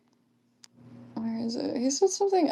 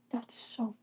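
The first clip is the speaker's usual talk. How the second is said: whisper; 7 dB quieter than usual. Real, not acted. Stifled disbelief at chat.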